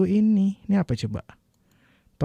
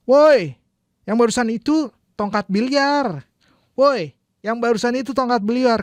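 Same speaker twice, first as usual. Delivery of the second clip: shouting to the crowd; 8 semitones higher than usual. Shown as a demonstration. Teacher shouting the natural Indonesian version to the crowd. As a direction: yell woi over a tense crowd. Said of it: "dia perlu teriak gitu".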